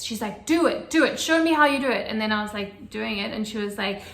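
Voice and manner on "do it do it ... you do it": loud, higher, insistent; urgent orders. Retold or imitated. Midwife urging the mother to push, loud and insistent. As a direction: push her to do it now. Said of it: "She was, like, really serious".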